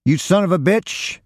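high-pitched angry yell. A narrator's reading. Robbed man grabs Roy's coat pockets, yelling. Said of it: "he yelled".

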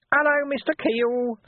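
Nobby sidling up with an oily hello; glutinous voice. Acted. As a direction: ooze the greeting like a sly urchin. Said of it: "said a glutinous voice".

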